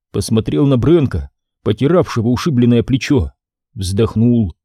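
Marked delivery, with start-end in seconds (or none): none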